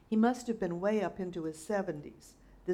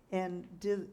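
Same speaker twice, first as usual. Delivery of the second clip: plain reading voice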